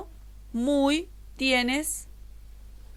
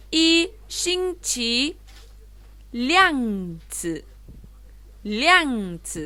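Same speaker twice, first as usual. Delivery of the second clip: slow reply read; 2 semitones higher, 5 dB louder, much slower than usual. Shown as a demonstration. Teacher reads the blue reply aloud for students, slowly. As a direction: read the answer slowly for them. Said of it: "la segunda vez".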